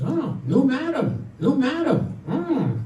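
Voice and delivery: high, slow parrot squawk; talking parrot. Acted. Parrot looks around its new home, squawking. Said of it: "the parrot looks at the woman and says".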